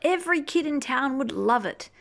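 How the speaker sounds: gentle storybook read-aloud; reading to children. Acted. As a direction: read it like a bedtime picture book. Reading picture book aloud.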